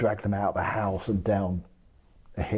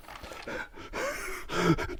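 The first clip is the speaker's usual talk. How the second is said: breathy laugh; 11 semitones higher, 6 dB quieter than usual. Real, not acted. Reads funny guest book entry.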